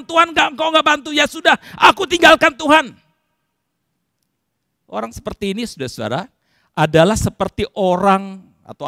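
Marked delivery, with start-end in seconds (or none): 0.0-3.0 loud, high-pitched outburst; angry at God. Acted. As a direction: give up on God in anger. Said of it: "Udah marah-marah ke Tuhan". (marah, lantang dan ketus)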